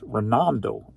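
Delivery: normal talking voice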